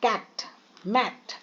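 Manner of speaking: कैट, मैट with a quick short vowel. Shown as a demonstration. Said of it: "वहाँ short में कैसे पढ़ रहे थे".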